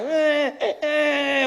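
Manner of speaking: high mock baby crying "Eh, eh"; imitated baby cry. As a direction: whimper "Eh, eh" like a baby. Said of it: "Other babies are crying like".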